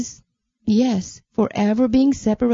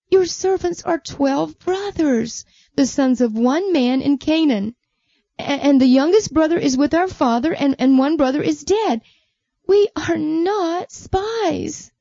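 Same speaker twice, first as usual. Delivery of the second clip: upset brothers; 6 semitones higher than usual. Acted. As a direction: beg him to believe you. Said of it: "how upset those brothers were".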